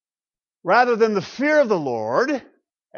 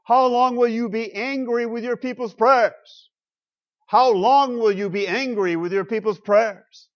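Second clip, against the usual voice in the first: raised, emphatic lament; voiced complaint; 5 semitones higher, 5 dB louder than usual. Psalmist setting forth his complaint to God, raised complaining voice. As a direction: put the complaint forcefully, higher and louder. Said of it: "now the complaint is set forth".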